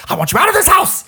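high, loud mock yell; hammed-up furious shout. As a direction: yell him out of the house. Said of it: "he's like".

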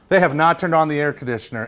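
usual speaking voice

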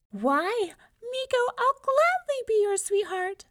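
very high, loud, delighted mouse voice; squealed delight. A narrator's reading. Mouse accepts Miko as her sweetheart, squealing in delight. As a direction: squeal happily as a tiny mouse. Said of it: "The mouse squealed in delight".

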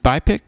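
"bipic" said as bye-pick, questioning; acronym sounded out. Shown as a demonstration. Host asks how to say the acronym, sounding out "bipic" as bye-pick. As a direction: try "bipic" as BYE-pick, loud and unsure. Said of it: "how do you say this acronym".

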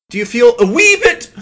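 high, fast squeaky Irish lilt, voice cracking like puberty; mock Irish accent with a pubescent squeak. Podcaster imitating the teen leprechaun's slipping Irish accent. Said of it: "slip into the Irish accent".

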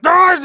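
sudden high yell of rage; high angry yell. Retold or imitated. Freddy's hammed-up furious yell on hearing the news. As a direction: let out a mock cry of fury. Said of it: "He's like".

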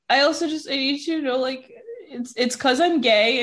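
high, faltering confession; retold upset. Coming out to mom on the phone, retold upset voice. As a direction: imitate getting hard news out tearfully, bit by bit. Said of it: "I'm so upset and emotional".

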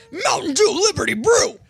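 high, loud, wild announcer yell; crazy voice. Acted. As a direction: shout it like a maniac hype man. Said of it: "I need to use my crazy voice for this".

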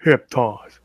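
flat SpongeBob meme deadpan. Pretend. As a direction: say it dry like the meme. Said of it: "meme voice, by the way".

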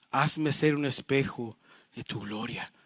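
hushed, low, drawn-out closing line; slowly, softly, pausing before "de tu gloria"; slow solemn reading. Real, not acted. Pastor ending the Puritan's prayer, slow and solemn.

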